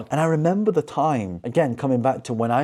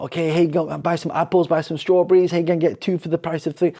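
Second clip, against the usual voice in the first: market vendor calling out; 6 semitones higher than usual. Retold or imitated. Retelling the vendor calling out deals to passersby, hammed-up hollering. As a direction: bark the deals across the stalls. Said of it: "I go back to where he's like".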